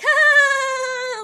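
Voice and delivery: mock eighth-grader date energy; overcompensating burst. Shown as a demonstration. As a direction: blurt it with giddy overexcitement. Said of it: "kind of that overcompensating".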